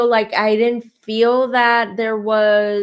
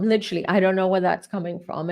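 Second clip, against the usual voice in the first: usual speaking voice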